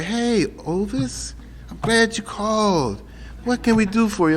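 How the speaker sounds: gentle hotline counsellor voice; nicest voice. Retold or imitated. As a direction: soothe a desperate caller on the hotline. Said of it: "He had the nicest voice".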